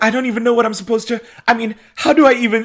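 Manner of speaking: high, loud, rushed panicky babble; mock frantic. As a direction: imitate someone frantic, words tumbling out high and fast. Acts Elena frantic over burning house.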